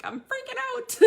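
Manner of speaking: high-pitched excited squeal; mock freak-out in a tiny, very high voice; overexcited. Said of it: "sometimes I get, like, overexcited".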